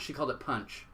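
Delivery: usual speaking voice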